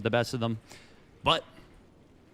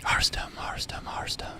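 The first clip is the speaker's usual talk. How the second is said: whisper. Pretend. Whispering the player's name dramatically.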